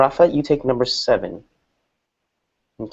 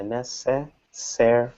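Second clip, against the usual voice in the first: "necessary" slowly split into four syllables; 5 dB quieter, much slower than usual. Shown as a demonstration. Teacher working out the stress, "necessary" syllable by syllable. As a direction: softly sound out each syllable of "necessary". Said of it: "There are four syllables here".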